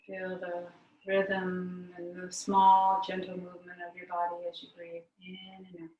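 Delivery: calm meditation voice with very long pauses; guided meditation. Real, not acted. Yoga teacher guiding the class to notice breath, guided meditation. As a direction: lead them inward, leaving long silences between phrases.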